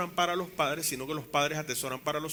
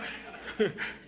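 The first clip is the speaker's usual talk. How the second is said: laugh; 5 semitones higher than usual. Real, not acted. Laughing at mother's remark.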